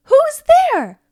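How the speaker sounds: loud high shout into the dark; startled call. Acted. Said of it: "we have an echo in here".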